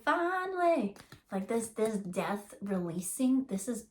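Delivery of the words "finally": high, relieved cry of joy; a hammed-up burst of relief; mock exclaiming angels. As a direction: cheer it like angels at last. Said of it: "the angels are like".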